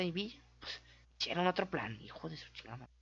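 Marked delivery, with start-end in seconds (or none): 2.0-2.9 muttered curse. Real, not acted. Curse muttered under the breath.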